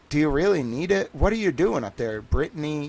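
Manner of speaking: ordinary delivery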